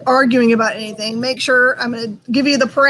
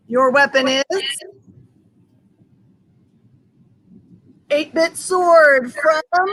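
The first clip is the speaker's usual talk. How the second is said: slow, drawn-out arena announcer boom; booming fighting game announcer; slower than usual. Acted. Host announces the next weapon.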